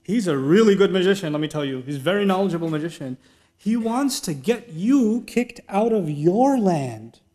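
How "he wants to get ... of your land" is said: usual speaking voice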